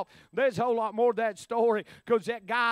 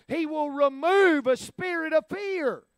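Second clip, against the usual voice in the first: emphatic preaching; 5 semitones higher, 4 dB louder than usual. Real, not acted.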